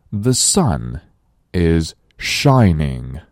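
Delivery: slow, careful model sentence for learners; slow model reading. Shown as a demonstration. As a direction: read it out slowly for the class. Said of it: "我们再来大声朗读". (慢速示范朗读)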